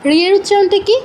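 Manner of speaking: normal talking voice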